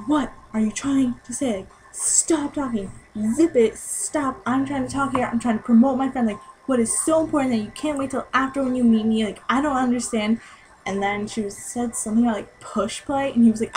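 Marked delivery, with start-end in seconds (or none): 0.1-10.4 sassy retort. Retold or imitated. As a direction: act out a sassy telling-off of a heckler. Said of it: "he got so sassy with this girl".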